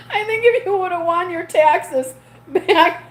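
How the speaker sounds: laughing. Real, not acted. Teasing a viewer about taxes, laughing. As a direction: laugh as you tease a viewer about losing.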